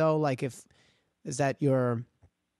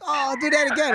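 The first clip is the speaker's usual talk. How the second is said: high-pitched, excited begging for a repeat; mock delighted fan; 11 semitones higher, 4 dB louder than usual. Fans asking to hear the accent, mock delighted. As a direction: gush like a tickled out-of-towner.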